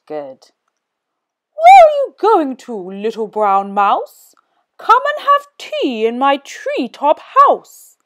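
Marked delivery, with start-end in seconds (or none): 1.5-8.0 high hooting owl invitation. Acted. Owl invites the mouse to tea, high and sweet. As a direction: coax the mouse like a hungry owl.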